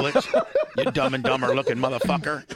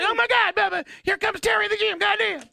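high, loud shouting promo voice; Jimmy Hart shouting a high-pitched promo; Jimmy Hart impression; 3 dB louder than usual. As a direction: yell it like a manic wrestling manager. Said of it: "his high-pitched promo cutting voice".

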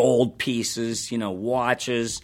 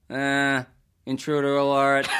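artificial larynx voice. Retold or imitated. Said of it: "Reference to a classic video game".

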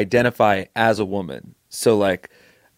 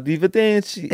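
Italian accent; 9 semitones higher than usual. Shown as a demonstration. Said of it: "Hey, that's Italian".

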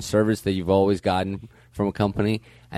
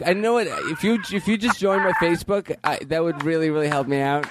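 needy service rep; 7 semitones higher than usual. Acted. Plays needy service rep on phone.

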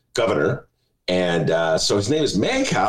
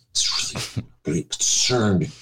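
mock concern; 7 semitones lower than usual. Mocking editorial pages.

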